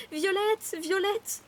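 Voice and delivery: high, rising calls of a name; anguished calling out. A narrator's reading. Narrator calling a sister turning into a doll, anguished calls. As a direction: call out to someone slipping away. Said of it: "Je l'appelais".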